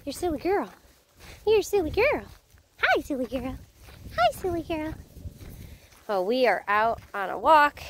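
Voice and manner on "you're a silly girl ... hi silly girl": high sing-song voice for the dog. Real, not acted. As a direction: coo at the dog, pitched up. Talking to her dog.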